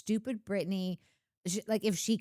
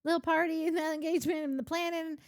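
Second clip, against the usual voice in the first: high, fast put-on whine of a left-out friend; mocking Kristen's complaint in a high whiny voice; 7 semitones higher than usual.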